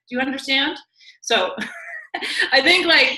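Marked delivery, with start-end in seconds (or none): 1.6-2.5 squealing laugh. Real, not acted. Laughing at own story.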